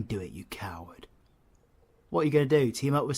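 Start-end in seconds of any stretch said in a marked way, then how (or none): 0.0-1.1 whispered mocking jab; a sly whispered dare; taunting whisper. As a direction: hiss the insult under your breath. Taunting the viewer.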